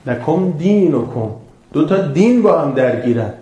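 ordinary delivery

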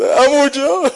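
high, loud wail of uncle; boy's dying call to his uncle, wailed; wailed cry. Acted. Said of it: "یک کلمه صدا زد".

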